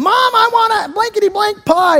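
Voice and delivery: put-on high-pitched bratty kid yelling at mom; imitated yelling kid. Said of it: "he's still yelling at Mom".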